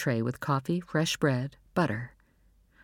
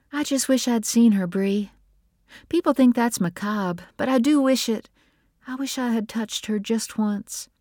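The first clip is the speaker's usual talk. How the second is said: lightly voiced grieving mother, higher; wistful grieving Nora; 9 semitones higher than usual. A narrator's reading. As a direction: confide a wish with a touch of sorrow, pitched up.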